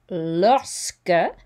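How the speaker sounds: "lorsque" said slowly as lor-skuh, QUE like a K; slow model French. Shown as a demonstration. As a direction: lorsque drawn out and clearly shaped. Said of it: "I will go through this slowly".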